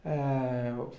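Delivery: normal talking voice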